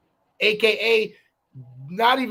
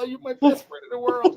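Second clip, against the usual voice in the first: mock crying friend; 5 semitones higher than usual. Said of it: "y'all can cry on demand".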